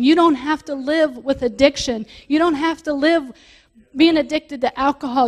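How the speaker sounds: full-force sermon; preaching. Real, not acted. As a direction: hammer each line home to the church.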